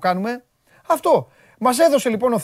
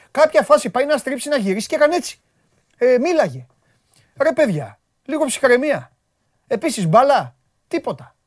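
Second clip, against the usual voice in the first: ordinary delivery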